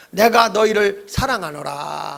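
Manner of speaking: slow, drawn-out solemn voice of God; God's declaration. Shown as a demonstration. Preacher cueing the upper floor as God, slow and solemn. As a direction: stretch it out grandly as God. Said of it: "한번 우리 리얼하게 해봅시다".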